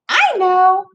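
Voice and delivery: high sing-song mom voice. Acted. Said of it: "says Mom".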